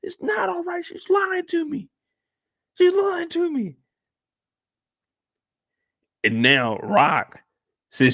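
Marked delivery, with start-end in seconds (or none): 0.0-3.8 high, wounded whine; upset teen son. Acted. Kanan telling Jukebox about his mother's lies, high and hurt. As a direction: protest in a raised, plaintive pitch.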